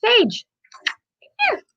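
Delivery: very high coaxing call; calling a pet. Real, not acted. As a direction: summon the animal in a high voice. Calling her pet over.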